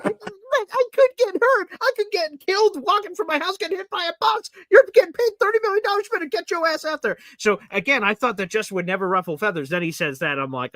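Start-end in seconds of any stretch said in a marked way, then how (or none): 0.0-4.5 mocking whiny player. Mocking the player's excuse, whiny and high. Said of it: "But, but". 4.7-7.2 high, put-on outraged fan voice, hammed up; scolding like an indignant fan; mock outraged fan. Fan scolding a backup quarterback, mock outraged. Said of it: "including myself, like".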